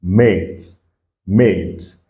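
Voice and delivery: mate said as "met", long A; mate pronounced met, not mat; model word. Shown as a demonstration. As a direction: repeat mate with the English long A sound. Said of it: "nous avons mate".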